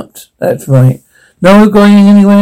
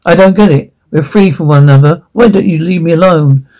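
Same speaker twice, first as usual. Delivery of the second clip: normal talking voice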